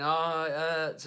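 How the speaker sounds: slow, high mock wail of agony; crying in pain. Child at every snip of haircut, mock agonised cry. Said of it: "crying in pain, saying he's in agony".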